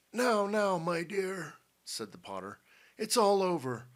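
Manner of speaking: consoling Potter. A narrator's reading. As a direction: soothe your crying daughter. Potter comforts his crying daughter.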